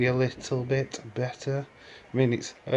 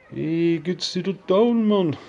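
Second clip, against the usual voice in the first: high, loud mock Jamaican accent; hammed-up Jamaican shrunken head voice; 9 semitones higher, 7 dB louder than usual. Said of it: "I can't do a Jamaican accent".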